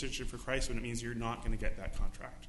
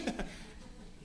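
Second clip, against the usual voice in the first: laugh; 7 dB quieter than usual. Real, not acted. Laugh during scene.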